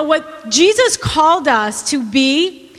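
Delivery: normal talking voice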